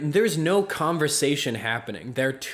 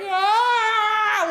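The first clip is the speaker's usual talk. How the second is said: high, loud imitated shriek; mock scream; 7 dB louder than usual. Bartender imitates hysterical girl, mock scream. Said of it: "She's screaming".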